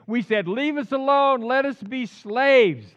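loud, high acted plea; hammed-up desperate begging; pleading outcry. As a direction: cry it out, stretching the last words. Voices the panicking people pleading.

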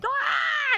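very high, loud mock shriek; roller coaster scream. Pastor acting out the screaming mother from a meme, loud high mock scream. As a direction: shriek like a terrified rider. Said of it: "she's going".

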